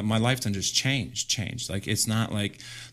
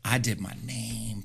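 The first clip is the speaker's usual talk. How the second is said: ordinary talk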